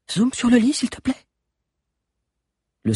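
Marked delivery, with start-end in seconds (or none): 0.1-1.3 put-on high pleading young voice; Agnès voice. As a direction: ask it up high, urgently. Agnès asks again.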